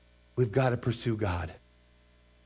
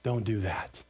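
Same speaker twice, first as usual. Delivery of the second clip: tender pleading father; 5 semitones lower, 5 dB quieter than usual. Retold or imitated. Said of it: "he's saying".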